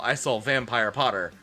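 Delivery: ordinary talk, nothing marked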